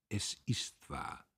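soft, slow, breathy confession; quiet admission. A narrator's reading. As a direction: admit it under her breath. Emmy admits quietly.

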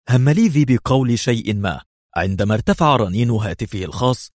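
ordinary delivery, nothing marked

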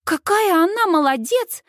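high, thrilled praise; proud, elated exclamation; delighted. A narrator's reading. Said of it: "в восторге выдыхает Сьюз".

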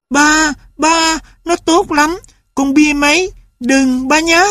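put-on high child begging father; a boy begging to keep his dog; pleading boy. A narrator's reading. Tulip pleads with his father, high and earnest.

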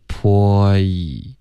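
stretched "泼一", sliding from "po" into "ee"; gliding vowel. Shown as a demonstration. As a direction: glide "泼" into "一" as one syllable. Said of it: "再滑到一".

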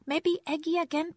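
put-on high, loud little-child voice; high toddler wheedle. A narrator's reading.

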